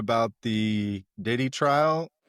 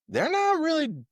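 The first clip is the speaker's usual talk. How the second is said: high emphasis; 14 semitones higher than usual. Real, not acted.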